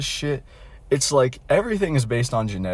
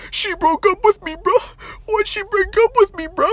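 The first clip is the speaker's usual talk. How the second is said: much higher, louder whiny wail, hammed-up; mock sobbing breakdown; 6 dB louder than usual. Friend wailing to his buddies after the breakup, mock sobbing. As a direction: mimic a dumped friend bawling, way up high. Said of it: "a complete fucking mental breakdown".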